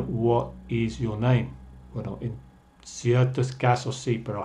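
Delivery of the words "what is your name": "What is" said as two separate words; full form "What is your name", nothing joined; uncontracted WHAT IS. Shown as a demonstration. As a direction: keep What and is apart before "your name". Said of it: "Nadie te va a decir".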